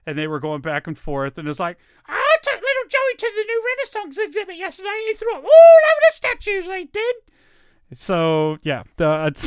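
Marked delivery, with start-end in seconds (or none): 2.1-7.2 put-on very high screechy British old-lady falsetto; shrill mother griping about her kid; Monty Python old lady. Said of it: "skit where the two old ladies".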